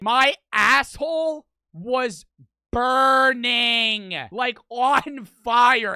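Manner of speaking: slow, loud, drawn-out complaint; emphatic loud. Real, not acted. Streamer telling chat about the pain, slow and loud. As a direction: lean hard on the burning, slow and loud.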